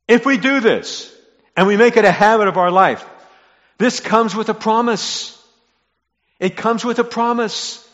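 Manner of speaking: loud, high-pitched proclamation; impassioned preaching. Real, not acted. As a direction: proclaim it to the whole congregation at full force. Preacher builds to the promise.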